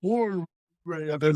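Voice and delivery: stretched, distorted slow-motion voice; mock slow-motion cry. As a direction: drag it out like slowed film. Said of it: "one of those slow motion moments".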